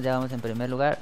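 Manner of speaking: normal talking voice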